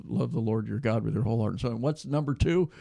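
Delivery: ordinary talk, nothing marked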